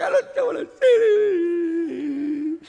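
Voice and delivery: high, slow, sobbing wail; fake-blubber the words; hammed-up weeping prayer; mock crying. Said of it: "crying so radically".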